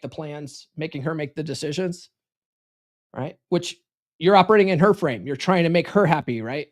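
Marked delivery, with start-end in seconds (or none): none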